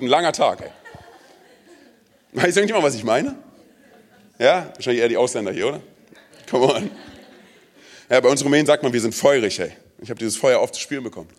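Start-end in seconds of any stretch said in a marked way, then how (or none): none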